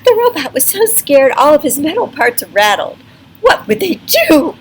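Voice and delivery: excited storyteller; dramatic narration. A narrator's reading. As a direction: sell the suspense to the listener.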